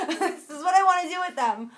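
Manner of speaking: giggling through a joke; laughing. Real, not acted. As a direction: laugh out the words. Joking about the yarn.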